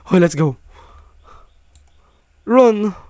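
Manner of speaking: loud, high cries urging to run; panicked shout. Real, not acted. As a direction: yell it while fleeing in panic. Fleeing monsters.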